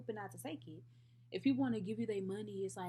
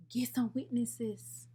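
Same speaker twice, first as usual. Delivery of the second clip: louder, higher, slowed-down demand; loud challenge; 4 semitones higher, 7 dB louder, slower than usual. Acted. Girl challenging Rashid over the tied race, loud demand. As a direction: throw down the challenge, each word punched loud.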